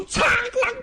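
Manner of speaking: 高声模仿拔剑的“擦啷”声; 尖亮地拟出宝剑出鞘声; sword ring sound. Acted. Sword drawn, mimicked sound. (说书人模仿拔剑出鞘的金属声)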